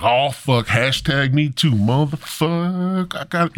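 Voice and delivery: high, drawn-out comic panic; mock paranoid. Stoned Adam panics at being woke, high and paranoid. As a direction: panicky wail, hammed up. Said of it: "he was paranoid".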